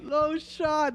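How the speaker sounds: amazed disbelief. Real, not acted. Amazed by fake Adidas.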